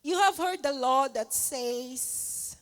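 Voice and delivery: slow, deliberate quoting of Jesus; measured, preaching quote of Jesus; slow scripture reading. Retold or imitated. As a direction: read it out slowly like scripture. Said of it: "Sabi niya sa thirty-eight".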